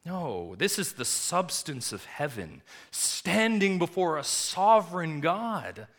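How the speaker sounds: loud, high preaching build; thundering pulpit voice; fiery preaching. Acted. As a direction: preach it with rising fire. Preacher building to full force.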